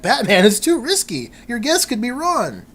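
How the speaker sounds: high, hammed-up alarm; horrified. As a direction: plead like a horrified comic-book sidekick. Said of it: "is horrified".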